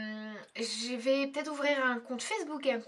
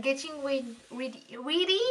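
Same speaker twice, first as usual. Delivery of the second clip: English words in a French speaker's English accent; playful go at an English accent; attempted English accent. Shown as a demonstration. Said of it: "Admirez mon accent anglais".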